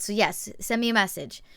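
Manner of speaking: ordinary delivery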